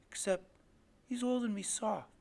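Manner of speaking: ordinary delivery, nothing marked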